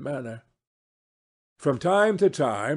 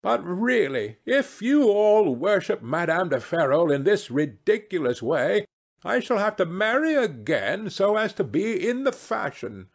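high, animated lady's voice; gushing hostess holding forth; playful society hostess; 9 semitones higher, 3 dB louder than usual. Acted. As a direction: declare it with mock exasperation, pitched up.

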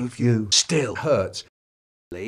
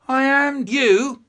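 booming godlike voice; 11 semitones higher, 8 dB louder, slower than usual. Acted. Cosmic being declares itself.

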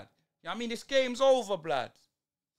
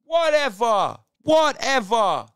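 fed-up shout; 3 semitones higher, 10 dB louder, slower than usual. Real, not acted. Fan fed up with match.

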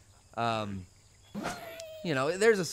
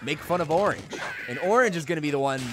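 ordinary delivery

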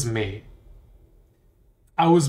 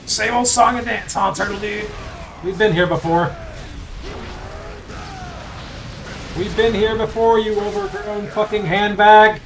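mock taunting; 6 semitones higher than usual. Taunting the turtle in game.

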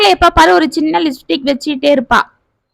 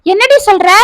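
alarmed question, higher pitched; panicked; 7 semitones higher than usual. A narrator's reading. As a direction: gasp it out in alarm. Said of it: "என்று பதறினாள் வள்ளி".

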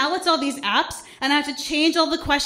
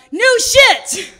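high, loud group shout; crowd yell; 8 semitones higher, 9 dB louder than usual. Retold or imitated. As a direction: holler it like a cheering crowd. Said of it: "everybody would yell out".

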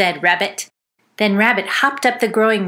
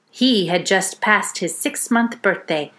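ordinary delivery, nothing marked

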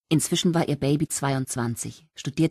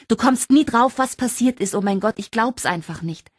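much higher and louder, tumbling out the news; bursting excitement; 7 semitones higher, 4 dB louder than usual. Acted. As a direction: spill the news, beside yourself with joy.

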